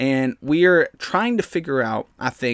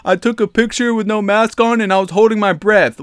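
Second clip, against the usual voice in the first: higher, louder mock voice; mocking the mayor; 7 semitones higher, 7 dB louder than usual. Mocking the California mayor's excuse, higher and louder. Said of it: "the mayor of California is over here like".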